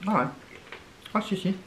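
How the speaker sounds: snack stick at lips; talking while chewing. Real, not acted. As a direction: mouth partly full. Seen 4: Woman talking holds thin snack stick at lips, cheeks chewing.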